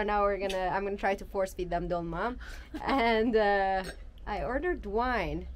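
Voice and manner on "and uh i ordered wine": ordinary talk, nothing marked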